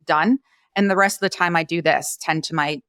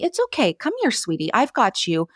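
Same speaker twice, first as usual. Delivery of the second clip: soothing puppy talk; 4 semitones higher than usual. Shown as a demonstration. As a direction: comfort a frightened puppy. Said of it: "How you would to a little puppy".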